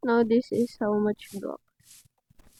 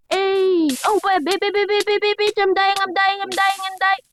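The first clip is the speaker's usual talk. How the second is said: panicked yelling; 9 semitones higher than usual. Real, not acted. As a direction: panic as you fall. Panics as character dies.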